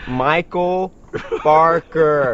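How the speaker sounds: over-pronounce it in American for the phone robot; loud, careful mock-American enunciation; put-on American phone pronunciation. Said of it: "when you, they get on this phone".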